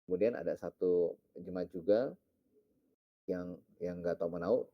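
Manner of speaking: ordinary delivery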